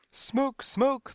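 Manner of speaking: high, repetitive parroted scream; mechanical scream. A narrator's reading. Crowd parrots the panic, screaming mechanically. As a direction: scream it by rote. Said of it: "they screamed mechanically".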